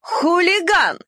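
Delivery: high, squealy bark; yapping bark. Acted. Dachshund barking at the puppy, high and yappy. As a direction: bark the word out shrilly. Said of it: "визгливо пролаяла Нелли".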